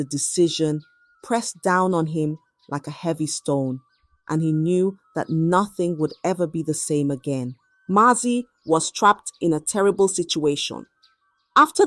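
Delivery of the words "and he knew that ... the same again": ordinary delivery, nothing marked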